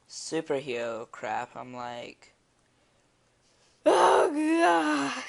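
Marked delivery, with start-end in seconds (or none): 3.9-5.2 loud, high, crying scream; hammed-up terrified scream, much higher and louder; screaming in terror. Shown as a demonstration. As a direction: shout it like a horror victim. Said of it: "Like that".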